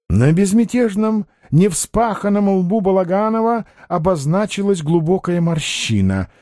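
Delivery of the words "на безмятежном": ordinary delivery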